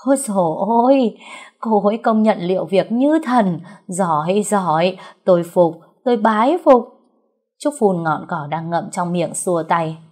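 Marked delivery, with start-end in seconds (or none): 0.1-6.8 gleeful admiration. Acted. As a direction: gush with awe at a job done. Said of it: "Ông Thắng cười như ma làm". (hớn hở thán phục, giọng cao và to)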